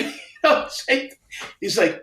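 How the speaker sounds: ordinary talk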